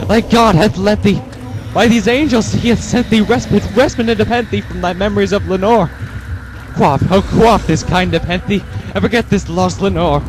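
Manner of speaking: high, loud pleading rant; desperate crying out. Acted. Grieving man pleads to forget Lenore, high and loud. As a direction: beg heaven for relief, voice strained. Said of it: "I cried".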